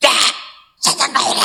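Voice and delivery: loud demonstrator's holler; angry shout. Retold or imitated. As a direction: shout it out like you'll smash the place. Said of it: "소리를 지르면서".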